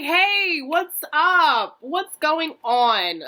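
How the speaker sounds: high, loud mock nonchalance; slow the last bit, acting casual; fake casual. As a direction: cover up with a too-bright hello. Fake casual greeting to granny.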